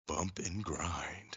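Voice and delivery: slow, hushed whisper. Acted. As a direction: whisper the naughty bit slowly. Sneaks in a risque phrase.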